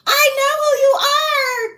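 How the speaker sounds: very high, loud, slow squeal of recognition; excited squeal. Retold or imitated. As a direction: shriek it like you just spotted someone famous. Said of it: "And I was like".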